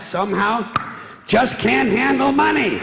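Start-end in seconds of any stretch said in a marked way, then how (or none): 1.3-2.8 mock disbelief. Mocking punchline.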